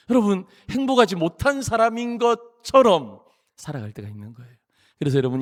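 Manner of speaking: ordinary talk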